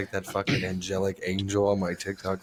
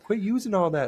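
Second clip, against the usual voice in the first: breakfast lady scolding; 11 semitones higher than usual. Retold or imitated. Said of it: "And she's like".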